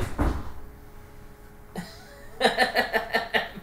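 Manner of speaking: long breathy burst of laughter; helpless wheezy laughing. Real, not acted. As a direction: crack up at your own jab. Laughing at his own jab.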